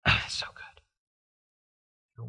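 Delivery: slow breathy whisper of delight; hushed, slow, amazed whisper; awed whisper. Retold or imitated. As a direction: whisper it like a secret treasure. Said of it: "And I go".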